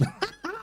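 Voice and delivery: high squeaky giggle burst; burst of laughter. Real, not acted. Caster watching a failed skill check, bursting into a giggle. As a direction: let a sudden giggle escape.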